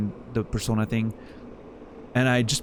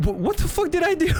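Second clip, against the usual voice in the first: bewildered. Retold or imitated. Confused viewer reacting to insult.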